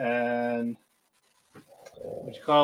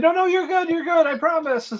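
much higher, bright insistent reassurance; high reassuring girl; 4 dB louder than usual. Retold or imitated. Girl reassuring Kana she looks good, high reassurance. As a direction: coax her out of it in a girly pitch.

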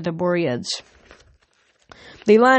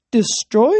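louder, higher, rising question; eager suggestion; 4 semitones higher, 5 dB louder than usual. A narrator's reading. Cal offers the dragon's fate, hopeful question. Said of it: "Cal suggested".

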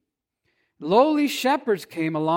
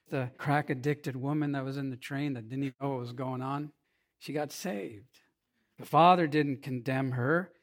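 softer, low-voiced retelling of a tragic choice; hushed sermon story; 5 semitones lower, 5 dB quieter than usual. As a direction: draw the congregation in, quietly and gravely. Sermon illustration told softly.

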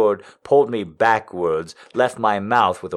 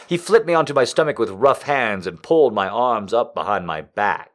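usual speaking voice

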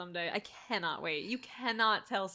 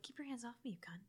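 soft, hushed threat; quiet menacing threat; 14 dB quieter, faster than usual. Retold or imitated. Imitating the possessed girl's whisper-soft threat. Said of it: "she says it so quiet".

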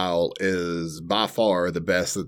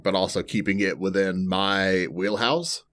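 normal talking voice

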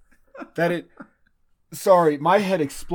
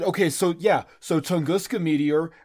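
usual speaking voice

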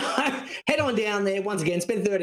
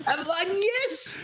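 high mock cry of triumph; retold elation; 12 semitones higher, much slower than usual. Man reliving getting his glasses back, mock elation. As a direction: act out a burst of joy, much higher.

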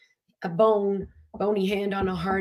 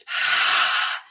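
calming exhale demo; 3 dB louder than usual. Shown as a demonstration. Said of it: "If you're in fear".

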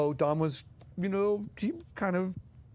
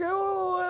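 high delighted oh. Retold or imitated. Dom's delighted exclamation.

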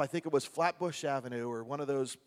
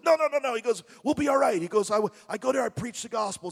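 put-on Brooklyn accent; 8 semitones higher, 6 dB louder, faster than usual. Retold Brooklyn guy reassuring.

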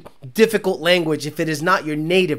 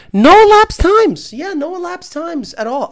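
mock dodging tuner; 9 semitones higher, 6 dB louder than usual.

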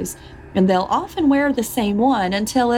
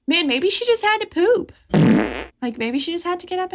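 higher, silly husband voice; mock husband; 10 semitones higher than usual. Host voicing the husband who never thought of the bathroom, silly high. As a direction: play the clueless husband for laughs.

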